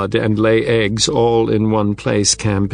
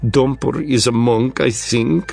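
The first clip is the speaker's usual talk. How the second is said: put-on Russian accent; 2 semitones higher than usual. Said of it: "in a thick Russian accent".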